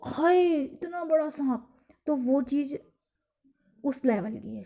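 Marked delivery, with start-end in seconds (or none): 0.0-1.7 higher, slow startled cry at a snake; mock fright. Shown as a demonstration. Teacher acts out alarm at a snake for class. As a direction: act shocked by a huge snake. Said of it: "पर इसी चीज़ को".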